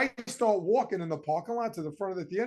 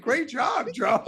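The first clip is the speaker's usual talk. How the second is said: mock cheer, high and loud; cheering dad; 6 semitones higher, 4 dB louder, slower than usual. Father loudly cheers Joe inside the empty theater, hammed-up. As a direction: shout praise like a thrilled dad.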